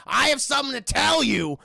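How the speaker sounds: loud, high acted announcement; mock confrontation. Stan confronting Mister Gleeful, loud and forceful.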